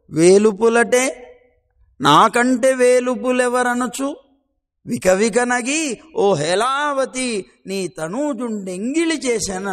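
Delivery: slow, loud poem recital; boastful Krishna chanting a verse slowly. Acted. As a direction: recite the verse with grand weight.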